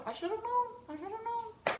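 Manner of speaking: mock whining. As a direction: whine it to yourself dramatically.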